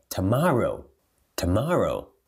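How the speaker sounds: "Tomorrow" with weak "tuh", long "MAH"; stretched stressed vowel. Shown as a demonstration. Teacher modelling the whole word, "Tomorrow" stressed on "MAH". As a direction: stress the middle of "Tomorrow", falling after it. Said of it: "é que você vai alongar ele".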